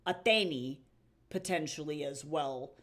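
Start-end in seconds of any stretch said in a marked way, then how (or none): 0.0-0.8 Athene with an open ay in the middle; Attic accent variant. Shown as a demonstration. Streamer shows Athene in their weird accent, variant pronunciation. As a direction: say Athene as ah-THAY-nee. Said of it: "with their weird accent".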